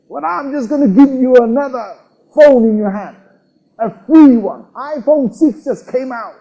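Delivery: high, loud mock-outraged father; angry father. Father scolding his son while promising gifts, acted anger. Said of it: "that father is angry with his son".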